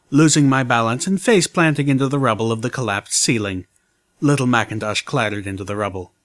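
ordinary delivery

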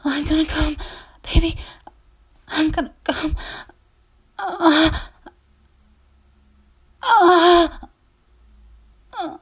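high loud cries; loud climax cries. Real, not acted. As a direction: cry it out at climax.

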